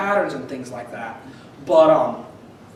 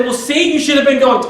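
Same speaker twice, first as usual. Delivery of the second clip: punchline retort; 8 semitones higher, 8 dB louder than usual. Retold or imitated. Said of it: "And she said".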